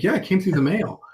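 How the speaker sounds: high, breezy, as if nothing odd; mock matter-of-fact. Salesman telling the gatekeeper the elephant came by mail, high and breezy. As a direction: toss it off lightly, pitched well up.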